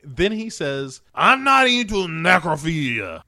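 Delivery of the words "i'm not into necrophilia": loud, high, hammed-up protest; mock outraged denial. Billionaire answers her question about the night, loud mock outrage. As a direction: burst out in exaggerated indignation.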